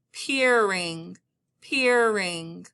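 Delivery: PEERING run together, r carried into the ending; drawn-out word. Shown as a demonstration. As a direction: say "peering" long, without a break. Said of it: "depending on how I say".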